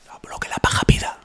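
a breathy whispered catchphrase. Shown as a demonstration. Whispering the motto to his podcast host. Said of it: "Podéis hacer un politono de esto".

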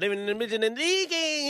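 high, loud hyped-up rap ad-lib voice; mock rapper ad-lib. Riffing to friends about his imagined music, mock rapper ad-lib. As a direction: ham it up like a trap rapper on a hook.

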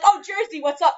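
high mocking greeting; imitating a friend's ribbing; teasing coworker. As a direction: call her Jersey in a high tease. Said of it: "be like".

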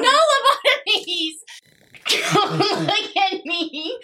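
high, giggling protest; laughing. Real, not acted. Laughing fit.

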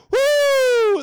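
high shrieking woo; a wild high woo; Ric Flair woo. Retold or imitated. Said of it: "Ric's signature call".

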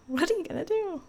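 high, playful rhetorical question; high exclaim. Shown as a demonstration. Repeated louder and higher.

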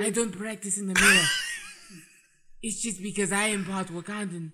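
keeps a loose mock Wakandan accent; light mock Wakandan accent, laughing. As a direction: defend himself in a playful Wakandan accent. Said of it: "in Wakandan accent".

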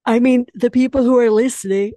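yawning through the words. Real, not acted. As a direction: speak mid-yawn, mouth stretched open. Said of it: "oh my God, excuse me".

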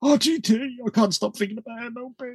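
high, soft whiny gamer voice; imitating obsessed gamer. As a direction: whine like a sleepless gamer. Teasing co-host as tired gamer.

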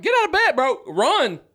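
high, loud mock yell at the captain; panicked hammed-up urging to hurry; frantic yell.

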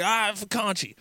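lightly put-on Philly accent. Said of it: "then the whole Philly thing".

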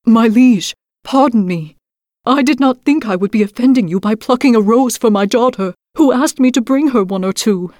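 pleading. A narrator's reading. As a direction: beg for mercy on your knees. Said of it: "pleaded with the beast".

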